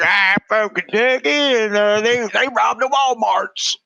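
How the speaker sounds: garbled mock hillbilly news interviewee. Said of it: "toothless people".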